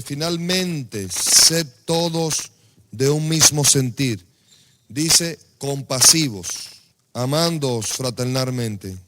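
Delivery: loud, slow, proclaimed Bible verse; forceful scripture reading. Acted. Pastor reading First Peter to the church, loud and slow. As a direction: proclaim each word of the verse.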